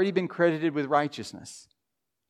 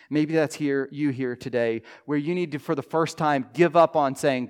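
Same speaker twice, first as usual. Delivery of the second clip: ordinary delivery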